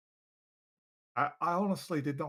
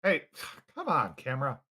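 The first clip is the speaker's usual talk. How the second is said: ordinary talk, nothing marked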